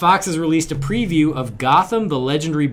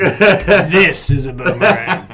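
very slow, louder, laughing Crocodile Dundee punchline; mock Aussie; 3 semitones higher, 8 dB louder, much slower than usual. Co-host finishes the Dundee joke, in a slow loud mock Aussie voice. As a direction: land the punchline slow and big, Aussie style.

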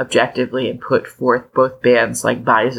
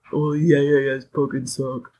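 dumb guy voice. Retold or imitated. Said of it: "my dumb guy voice?".

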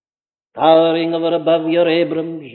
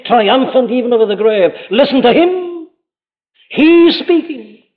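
rising, louder climax of the sermon; fervent preaching; 5 semitones higher, 3 dB louder than usual. Acted. Preacher urging the congregation to listen to Christ, fervent and rising. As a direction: build it up, then lift high on the last call.

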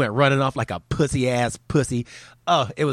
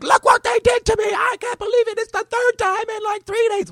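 much higher, loud whining impression; whiny mock victim; 4 dB louder than usual. Mocking the pepper-sprayed man, high and whiny. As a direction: whine like a crybaby playing victim. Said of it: "he's all like".

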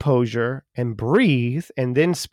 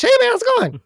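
very high squeaky voice, much higher; much faster than usual. Retold or imitated. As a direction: play a little squeaky person. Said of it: "like a little squeaky person".

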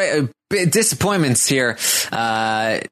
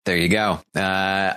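normal talking voice

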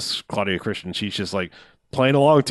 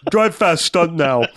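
high, hammed-up stunt guy; goofy hype; mock stuntman; 8 semitones higher, slower than usual. Riffing on the brother gone stunting, hammed-up high voice.

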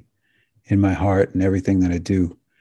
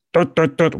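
high, clipped tut tut tut like a robot; a little mechanical tut sound mocking dry data talk; mock robot tutting; 9 semitones higher than usual. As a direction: tap out robotic tuts, playfully.

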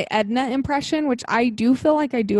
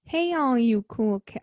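attempted Carole Baskin; slower than usual. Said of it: "I can't do it".

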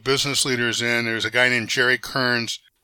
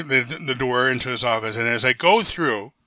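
usual speaking voice

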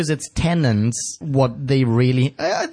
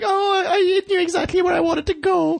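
mock crying. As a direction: wail it like a blubbering child. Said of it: "she was like".

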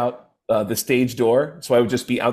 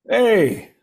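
Andrew Dice Clay; 8 semitones higher, slower than usual. Retold or imitated. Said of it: "I see Andrew Dice Clay".

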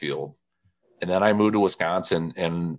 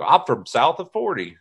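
imitated southern-Indiana country accent, pitched up; mock rural southern-Indiana accent; 7 semitones higher than usual. Auctioneer kid saying where he's from, mock southern-Indiana accent. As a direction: put on a higher southern-Indiana twang. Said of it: "gave him crap about his accent".

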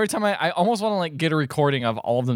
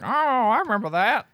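higher put-on voice of fond recollection; reminiscing old viewer; 7 semitones higher than usual. Elderly viewer recognising their own past on screen, put-on reminiscing. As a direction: play an elderly viewer remembering. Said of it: "people still alive watching when this movie came out".

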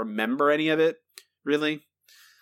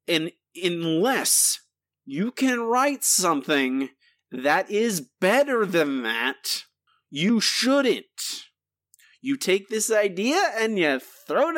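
slow emphatic rant; 5 semitones higher, slower than usual. Real, not acted. Ranting at the episode's writers.

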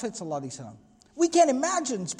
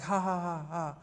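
imitated awkward chuckle, low and subdued; mock a nervous tension-breaking laugh; 3 semitones lower, 7 dB quieter than usual. Umar trying to break the tension, imitated awkward laugh. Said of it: "chuckles or laughs kind of awkwardly".

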